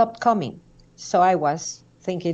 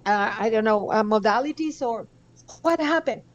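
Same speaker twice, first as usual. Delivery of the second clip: amazed questioning; 7 semitones higher than usual. Retold or imitated. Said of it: "doctor questioning patient".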